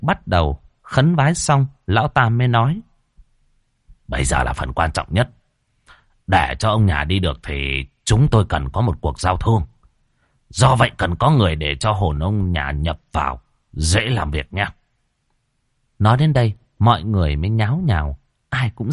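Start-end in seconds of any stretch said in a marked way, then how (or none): 4.1-14.7 put-on deep, grave old master; low stern sorcerer. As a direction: speak low like a solemn shaman. Tam's voice in story.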